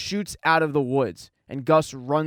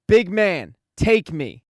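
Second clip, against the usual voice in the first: a slightly louder mock call from afar; mild retold yell; 5 dB louder, slower than usual. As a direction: call it out, but hold back the shout. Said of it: "he yells from a mountaintop".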